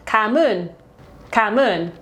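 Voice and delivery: Ca mun tried as the southern Vietnamese for thank you; a learner's earnest try at Ca mun; attempted Vietnamese. Shown as a demonstration. Travellers attempting southern thank you, careful learner try. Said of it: "our best attempt at saying "thank you".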